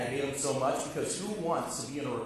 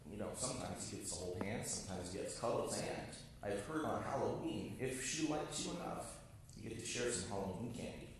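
teasing murmur; 4 semitones lower, 10 dB quieter than usual. Retold or imitated. Teasing kids about girlfriend.

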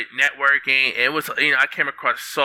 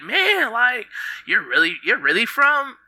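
much higher, wide-eyed awe; starstruck disbelief; 10 semitones higher than usual. Retold or imitated. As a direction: gush like meeting a celebrity. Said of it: "I was just so happy and excited to meet him".

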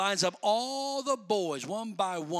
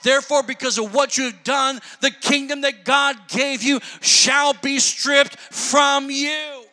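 prophetic judgment; 5 semitones higher, 7 dB louder than usual. Retold or imitated. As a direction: declare the verdict like a prophet. Samuel pronounces judgment on Saul.

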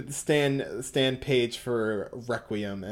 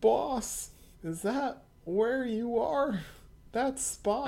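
high, slow squeaky voice; 10 semitones higher, slower than usual. Acted. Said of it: "read it in Bruno's voice".

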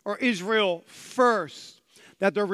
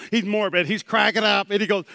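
ordinary talk, nothing marked

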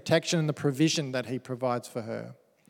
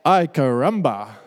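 Bart Simpson; 8 dB louder than usual. Retold or imitated. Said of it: "as Bart Simpson would say".

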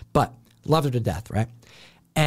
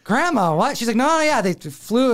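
put-on kid voice; 9 semitones higher than usual. Kid and grandma exchange.

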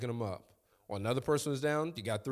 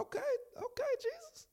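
eager squeal; 6 dB quieter than usual. Pretend. Pastor voicing himself, eager.

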